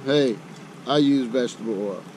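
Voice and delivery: normal talking voice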